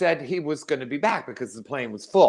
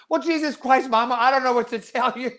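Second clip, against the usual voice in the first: much higher, strained, fed-up cry; exasperated outburst; 12 semitones higher than usual. Retold or imitated. Son fed up with his mother's bathroom refusal, exasperated high outburst. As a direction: throw up your hands at her.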